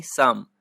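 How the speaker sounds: साम said as "saam", starting straight on S; silent P and L. Shown as a demonstration. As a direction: say साम with no P and no L sound. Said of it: "पी को नहीं पढ़ते हैं और एल को भी नहीं पढ़ते हैं".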